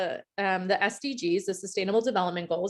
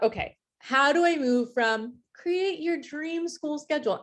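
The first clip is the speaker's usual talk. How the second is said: higher, sarcastic sing-song question; mock sarcasm; 7 semitones higher than usual. Real, not acted. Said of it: "I apologize for the almost mock sarcasm in my voice".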